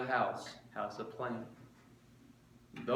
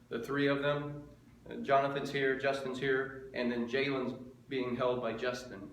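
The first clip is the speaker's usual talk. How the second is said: ordinary talk, nothing marked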